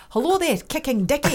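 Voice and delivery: playful put-on Scottish phone greeting; Scottish accent. Shown as a demonstration. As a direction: answer the phone as a Scot. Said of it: "What about Scottish accent".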